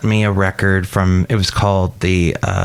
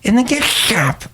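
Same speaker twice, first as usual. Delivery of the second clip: much higher put-on shopkeeper voice; chirpy high gift shop lady; high character voice; 12 semitones higher than usual. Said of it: "It's Shelley Shuhart".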